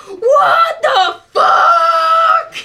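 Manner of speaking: long, high, drawn-out shout; distant outraged scream. Acted. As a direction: scream it from across a temple.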